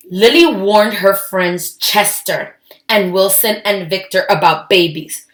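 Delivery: usual speaking voice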